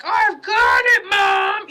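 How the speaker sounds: high, loud, exasperated yell; imitating a fed-up kid yelling at mom; mock shout at mom. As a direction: snap back at your mother, hammed up. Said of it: "and you're just like".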